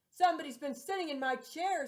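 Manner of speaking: big loud papa bear; great rough gruff voice. Acted. Papa bear finding his cushion moved, loud gruff voice. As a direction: boom it out like a huge bear. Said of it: "in a great, rough, gruff voice".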